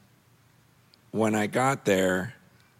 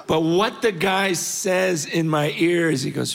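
ordinary talk

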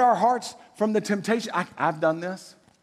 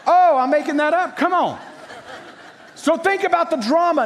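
laughing out loud, higher and louder; 11 semitones higher, 5 dB louder than usual. Real, not acted. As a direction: laugh as you admit the joke. Laughs at own made-up story.

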